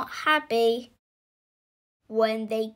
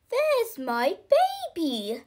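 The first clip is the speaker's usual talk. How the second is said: mock shout; 8 semitones higher than usual. A narrator's reading. Missus Clutterbuck spots her baby by the road, storybook shout. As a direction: call it out high, without real volume. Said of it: "shouted Missus Clutterbuck".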